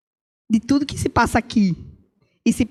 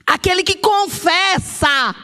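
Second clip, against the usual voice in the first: slow, ringing preacher's call; preached emphasis; 5 semitones higher, slower than usual. Real, not acted. Preacher drives the verse home, slow and ringing. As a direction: proclaim it slowly to the church.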